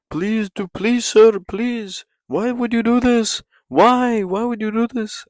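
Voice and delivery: high, whiny falsetto begging; mock pleading whine. Mocking a beaten fighter with a high whiny plea. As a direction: beg in a put-on squeaky voice.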